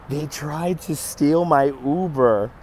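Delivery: drawn out word by word; slow, incredulous retelling; slow emphatic repeat. Real, not acted. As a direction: stretch it out slowly to stress the outrage.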